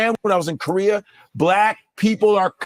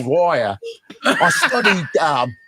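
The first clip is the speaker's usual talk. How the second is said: put-on British accent; 4 semitones lower, slower than usual. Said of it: "I'm watching B B C".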